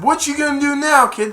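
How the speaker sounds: high, loud taunting quote; villain's taunt. Retold or imitated. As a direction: act out a movie villain's jeer.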